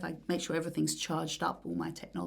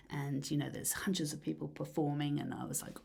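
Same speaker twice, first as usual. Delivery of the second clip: usual speaking voice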